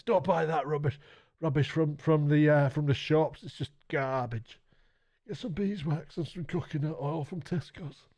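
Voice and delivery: lightly voiced gruff old craftsman; a hint of an old guy grumbling advice; old leather maker. Retelling the old leatherworker's advice, lightly voiced old man. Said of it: "That's how he sounded".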